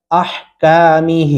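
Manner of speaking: अहकामिही with का and ही held; slow recited model of अहकामिही; two-alif elongation. Shown as a demonstration. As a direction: stretch the long vowels of अहकामिही slowly. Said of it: "यहाँ पे दो अल्फी मद्दा है".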